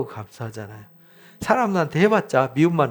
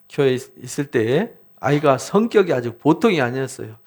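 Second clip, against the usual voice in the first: ordinary talk, nothing marked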